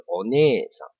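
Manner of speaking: お姉さん as one smooth run, ね held; joined long vowel. Shown as a demonstration. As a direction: glide お姉さん together. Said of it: "我全部粘在一起".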